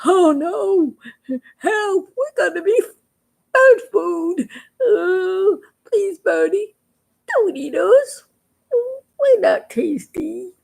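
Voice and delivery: high, tearful begging; scared pleading. Acted. Scared toads begging the Conno bird for mercy. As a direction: beg high and frightened not to be eaten. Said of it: "The toads were scared and said".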